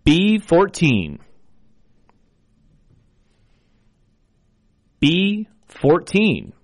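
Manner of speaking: slow, drawn-out number calling; bingo caller. Acted. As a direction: call each number clearly, then repeat. I will be your bingo caller.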